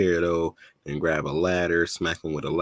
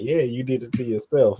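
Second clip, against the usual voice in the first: high taunting gloat; 7 semitones higher than usual. Retold or imitated. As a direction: rub it in, pitched up. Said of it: "I'm like".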